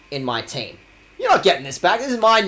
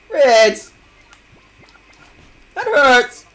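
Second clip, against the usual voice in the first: mock wounded cry; 7 semitones higher, 6 dB louder, much slower than usual. Mock hurt at being clipped.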